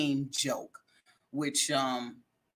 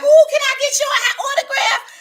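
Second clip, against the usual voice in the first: a much higher, loud, gushing fan shouting for an autograph; 9 dB louder than usual. Retold or imitated. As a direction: squeal it out like a starstruck fan begging a star. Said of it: "And they'll be like".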